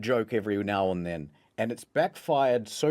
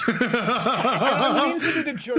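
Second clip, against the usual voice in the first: higher, louder, talking through real laughter; helpless laughing; 10 semitones higher, 6 dB louder than usual. Real, not acted. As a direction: laugh your way through the confession. Cracks up telling own blunder.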